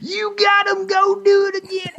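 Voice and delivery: put-on high hollering drunk fan. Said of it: "drunk rednecks who are like".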